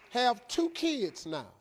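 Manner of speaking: ordinary talk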